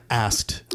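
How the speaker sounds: "asked" repeated alone after a laugh, falling; scare quotes. Real, not acted. Commenting on code switching, "asked" in scare quotes. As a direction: set "asked" apart as a wry quote. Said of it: "scare quotes around that".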